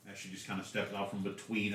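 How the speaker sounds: normal talking voice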